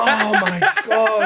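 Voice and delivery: laughing. Real, not acted. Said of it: "reacting laughing".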